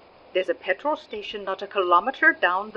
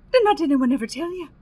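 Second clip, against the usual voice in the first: ordinary delivery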